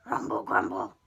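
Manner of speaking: very low, loud rumbling beast voice; monster grumble. Acted. Storyteller voicing the hungry monster's deep rumble. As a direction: rumble it out like a big-bellied beast.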